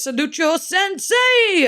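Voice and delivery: slow, high shouted imitation of the singer; imitating a shout. Reacting to a song, mimicking the singer's high slow shout. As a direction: belt it out slowly, mimicking him. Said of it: "And then he goes".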